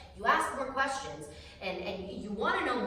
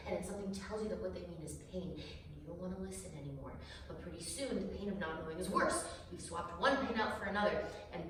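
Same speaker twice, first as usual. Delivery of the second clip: usual speaking voice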